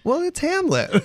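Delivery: high-pitched mock explanation; retold exasperated reply. Actor answering puzzled fans at Hamlet, mock exasperation. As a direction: pitch it way up, as if stating the obvious. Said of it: "And I'm like".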